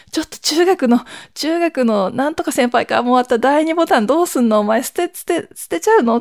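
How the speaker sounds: stunned she'd toss the second button; aghast teasing question. Retold or imitated. The speaker, finding the senpai's second button, quizzes her sister in disbelief. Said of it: "って言って".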